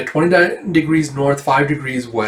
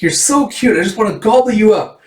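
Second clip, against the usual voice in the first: high-pitched, cutesy gushing; hammed-up high cooing tease; gushing teaser; 8 semitones higher, 4 dB louder than usual. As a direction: gush in a high voice over something adorable. Voices mischievous character teasing.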